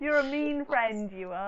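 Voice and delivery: playful sitcom-style telling-off; mock accusing TV quote. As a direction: slowly scold a friend, quoting a sitcom. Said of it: "if you watch Friends at all".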